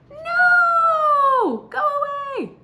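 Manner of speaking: furious high shout driving someone off; territorial shout. Acted. Territorial bird yells at the intruder, high furious shout. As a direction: yell them away from your turf.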